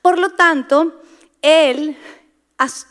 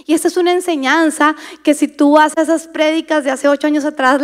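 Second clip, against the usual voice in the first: normal talking voice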